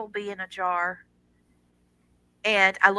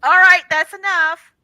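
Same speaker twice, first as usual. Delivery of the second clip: real surprise, higher and louder; startled; 8 semitones higher, 9 dB louder than usual. Real, not acted. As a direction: caught off guard as the door bursts open. Son bursting in during show.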